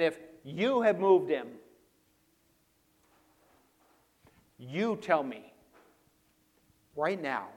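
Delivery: loud YOU, hard pauses between phrases; emphatic. Acted. Mary demanding to know who moved him, emphatic and insistent. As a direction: insist firmly, leaning on YOU. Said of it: "she's so emphatic here".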